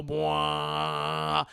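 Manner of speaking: long, loud horn blast; drawn-out honk made by mouth; imitated ferry horn. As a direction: blare like a big ferry horn. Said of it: "a horn from the ferry go".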